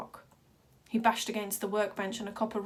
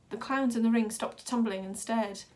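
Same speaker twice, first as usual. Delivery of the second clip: ordinary delivery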